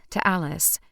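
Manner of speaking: plain reading voice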